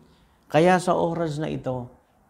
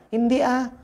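much higher, scoffing "no way"; scoffing denial; 10 semitones higher than usual. Acted. Preacher scoffing at claims about the windmill project, high mocking denial. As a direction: snap back in mock disbelief.

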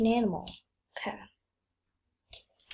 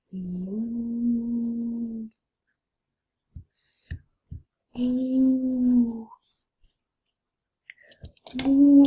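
drawn-out playful moo; a silly imitated moo; cow moo; 3 dB louder, much slower than usual. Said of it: "I'm a cow".

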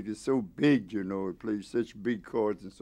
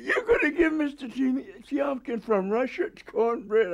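very high mock disbelief; imitated incredulity; 14 semitones higher than usual. Wife questioning husband about cornbread for Russian guest, incredulous. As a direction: mimic a scandalized wife, pitched way up. Wife's shock at cornbread, retold.

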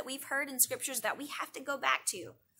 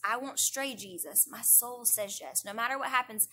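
heartfelt prayer; slower than usual. Shown as a demonstration. Speaker models a personal yes to the Lord, slow prayer. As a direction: pray it slowly, letting each yes sink in. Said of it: "we all have to come to that point where we say".

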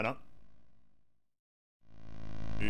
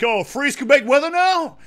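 raised-pitch mock quip; exaggerated, higher mimicking of cheesy dialogue; mocking game dialogue; 6 semitones higher, 3 dB louder than usual. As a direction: parody corny scripted banter. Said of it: "shut up talking like that".

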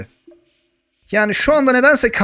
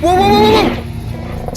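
jolted by a sudden scare, cry out; startled wow; 13 semitones higher, much slower than usual. Real, not acted. Gamer startled as dinosaurs storm his house, alarmed yelps.

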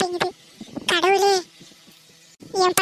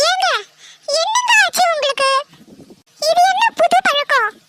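shocked pleading; 7 semitones higher than usual. Acted. Wife shocked at drunk husband.

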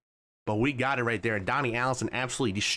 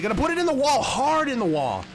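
excited suspense; 11 semitones higher, 3 dB louder than usual. Real, not acted. Rival about to crash.

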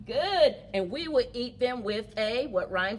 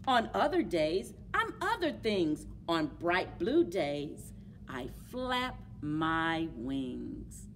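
put-on reading to children. A narrator's reading. Teacher reading My Many Colored Days, storytime voice. Reads picture book aloud.

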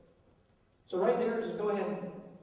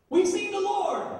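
higher and louder, joyful; excited; 9 semitones higher, 4 dB louder than usual. Retold or imitated. Disciples telling others, excited joyful announcement. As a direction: announce it with joy. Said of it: "They're all excited".

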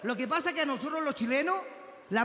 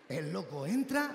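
ordinary delivery

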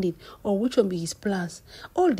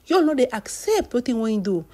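ordinary talk

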